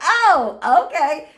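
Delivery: laughing through a surprised okay. Real, not acted. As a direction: giggle out the words in delight.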